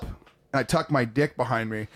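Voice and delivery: ordinary delivery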